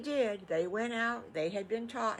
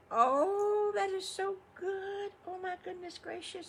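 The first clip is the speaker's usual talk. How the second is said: much higher, loud squeal of delight; 14 semitones higher, 5 dB louder than usual. Acted. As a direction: squeal like the food is a wonderful surprise. Delight at sweet potato fries.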